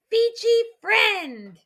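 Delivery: high, very slow drawn-out call; ceremonial shout. A narrator's reading. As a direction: cry it out for all to hear. Chief proclaims at ceremony.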